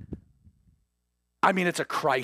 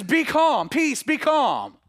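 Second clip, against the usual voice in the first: high, loud and slow command; stern command; 9 semitones higher, 5 dB louder, slower than usual. Retold or imitated. Jesus rebuking the wind, high loud slow command. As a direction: order the storm to stop. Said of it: "He says".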